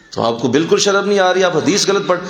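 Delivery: imitated heckler, pitched high; indignant scolding. Heckler shames a scholar mid-lecture, indignant. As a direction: scold as an outraged challenger would.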